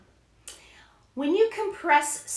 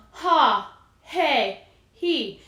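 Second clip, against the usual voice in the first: spoken H vowels; 6 dB louder, much slower than usual. Shown as a demonstration. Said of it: "in a speaking tone".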